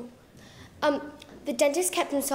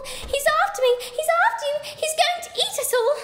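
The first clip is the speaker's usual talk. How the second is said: much higher, louder frantic cry; panicked shriek; 12 semitones higher, 3 dB louder than usual. Acted. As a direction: shriek it while scrambling in fear. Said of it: "the dentist shrieked".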